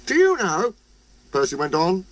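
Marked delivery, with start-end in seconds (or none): 0.0-0.7 high little engine voice. A narrator's reading. Percy speaks.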